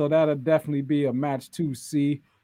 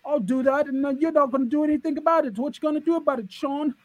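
higher, taunting heel promo impression; mock Waller; 10 semitones higher than usual. Mocking Waller taunting Sean from the ring. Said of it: "Jackass Waller, he says".

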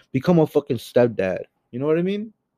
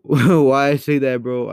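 laughing it out, louder and slower; embarrassed laugh; 3 semitones higher, 5 dB louder than usual. Real, not acted. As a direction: caught himself, laughing in embarrassment. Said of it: "I didn't mean to say that".

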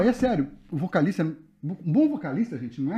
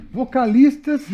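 voz aguda e alta, cheia de riso; falando rindo; 10 semitones higher, 8 dB louder than usual. Real, not acted. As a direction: tente falar segurando o riso. Riso depois da piada anterior. (fala atravessada por risada)

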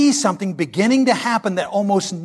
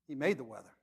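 much softer and lower, reverent; preacher whispering the punchline of awe; hushed awe; 7 semitones lower, 17 dB quieter, faster than usual. Acted. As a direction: drop to a reverent hush. Hushed awe after the build.

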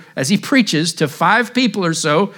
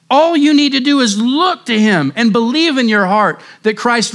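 high, impassioned altar call, drawn out at the end; fervent revival preaching; preaching at full force; 6 semitones higher than usual. Acted. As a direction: plead with the congregation to look and live.

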